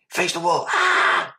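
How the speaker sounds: high shouted order then a sharp yelp; guard shouts and prisoner yelps, pitched high; mock guard bark and scream. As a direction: bark it, then cry out in pain. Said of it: "I've heard screams".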